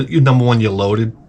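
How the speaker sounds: ordinary talk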